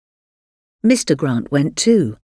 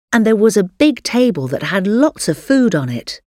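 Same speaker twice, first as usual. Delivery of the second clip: ordinary delivery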